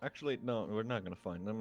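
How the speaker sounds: ordinary talk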